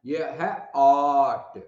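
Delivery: ये है ऑट said very slowly, ऑट drawn out; very slow teaching pronunciation; slow sound demo. Shown as a demonstration. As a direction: stretch ऑट so students hear the vowel. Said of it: "और".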